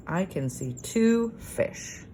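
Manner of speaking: slow, even reading of the sentence; slow careful reading. Shown as a demonstration. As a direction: read it out slowly for the children. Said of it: "sounds right".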